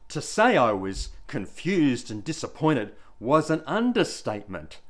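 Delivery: ordinary delivery, nothing marked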